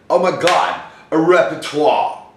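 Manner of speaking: loud, high, hammed-up awe; mock amazement. Actor pretending repertoire is news, mock amazement. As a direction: gasp it out in pretend astonishment.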